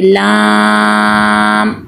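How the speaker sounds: लाम held very long on a steady note; elongated madd. Shown as a demonstration. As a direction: draw out the aa in लाम, then close to m. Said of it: "इसको ज़्यादा लंबा करेंगे".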